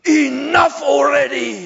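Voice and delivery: loud, high, slow indignant shout; heckling shout. Retold or imitated. Old German lady heckling the preacher, loud slow shout. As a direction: belt it out like a fed-up old lady.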